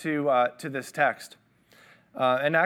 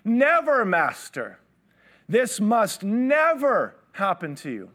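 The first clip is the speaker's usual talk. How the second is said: much higher, louder, slow protest on Never; rebuking; 6 semitones higher, 4 dB louder, slower than usual. Retold or imitated. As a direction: forbid it outright. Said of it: "he began to rebuke him".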